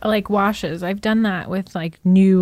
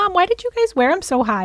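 put-on higher, puzzled kid voice; younger self asking mom; 9 semitones higher than usual. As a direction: play your young self questioning mom. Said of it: "I was like".

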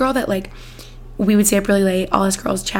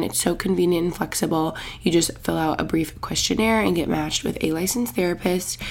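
ad read; 2 semitones lower, 4 dB quieter than usual. Acted. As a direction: read the ad smoothly and evenly. Sponsor ad read.

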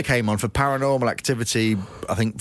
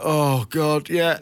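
disgusted groan; 6 semitones higher, much slower than usual. Retold or imitated. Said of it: "I'm like".

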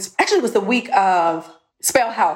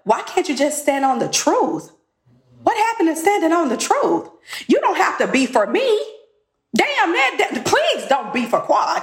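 exasperated plea; 6 semitones higher than usual. Retold or imitated.

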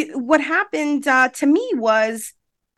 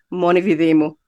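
low-pitched Calabrese dialect quote; Calabrese Italian; 6 semitones lower than usual. Retold or imitated. Daughter repeating her mom's warning, in low Calabrese Italian. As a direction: imitate a Calabrese mother, pitched down. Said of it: "Or that's Calabrese, she'd say".

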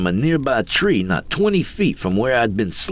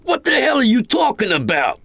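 high-pitched outraged outburst; incredulous roar; 12 semitones higher, 3 dB louder than usual. A narrator's reading. Greenhorn demands what Coyote is talking about, high roar. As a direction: explode in disbelief, pitch way up. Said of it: "I roared".

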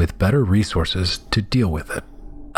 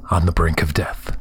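ordinary delivery, nothing marked

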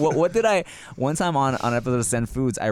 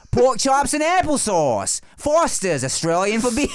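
Australian accent; 9 semitones higher, 3 dB louder than usual. Shown as a demonstration. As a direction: announce it like a caricature Australian beer advert. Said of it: "A Boston British accent is Australian".

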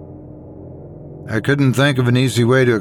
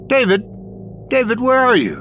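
high-pitched searching call; crying out; 11 semitones higher than usual. A narrator's reading. Ghost woman searching the boat for David, crying out. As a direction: call out for a missing husband, high but not shouted. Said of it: "she cried out".

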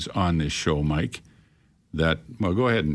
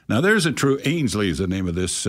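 ordinary talk, nothing marked

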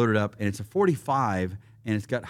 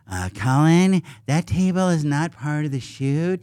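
higher, louder, slow put-on voice; production assistant; 5 semitones higher, 4 dB louder than usual. Assistant redirects actor to the right guns, slow put-on voice. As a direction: spell it out slowly for the actor, voice raised. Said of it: "then his P A runs up".

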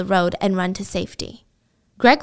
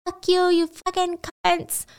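mock scream; 9 semitones higher than usual. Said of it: "screaming at the guards".

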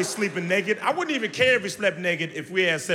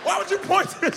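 usual speaking voice

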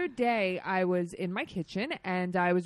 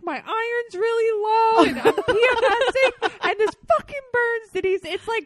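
much higher, louder, whining through the pain; whiny complaining self; 9 dB louder than usual. Retold or imitated. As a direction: wail it like a hurt, overwhelmed kid. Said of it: "Denise, like".

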